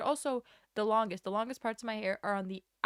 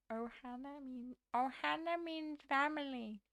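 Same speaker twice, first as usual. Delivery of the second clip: slightly higher, strained alien voice; Stitch impression; 4 semitones higher than usual. Shown as a demonstration. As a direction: squeeze it out like Stitch. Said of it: "I wanna keep doing the Stitch guy".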